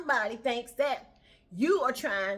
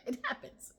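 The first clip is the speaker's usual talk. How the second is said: It happens breathed out through a laugh. Real, not acted.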